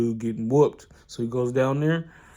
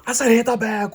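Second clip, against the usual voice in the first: high, loud furious command; with rage; 13 semitones higher, 9 dB louder than usual. Retold or imitated. The boxer yells at Ippo to hit the bag, furious and loud. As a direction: bark it like an enraged coach. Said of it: "with rage".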